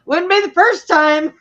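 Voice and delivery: high, loud joking reply; wry quip. Retold or imitated. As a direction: toss off a dark joke. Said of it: "I was like".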